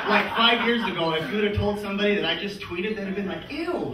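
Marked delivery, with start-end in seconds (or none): 3.5-3.9 high, swooping sound of disgust; grossed out. Acted. Listener hearing someone just tweeted, grossed out. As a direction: recoil from it in mock disgust.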